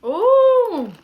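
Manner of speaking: high excited squeal; loud, thrilled whoop. Real, not acted. Cheering bowl progress.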